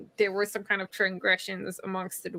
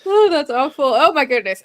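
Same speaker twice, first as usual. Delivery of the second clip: mock dismay; 5 semitones higher, 9 dB louder than usual. Real, not acted. Reacting to the elves' cruelty.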